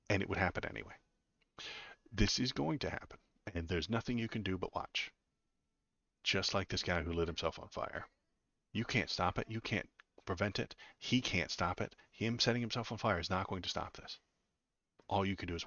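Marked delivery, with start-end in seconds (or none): none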